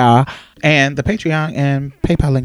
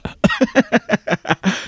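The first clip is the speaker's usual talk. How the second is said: amused laugh; 7 semitones higher than usual. Real, not acted. Laughing at own brag.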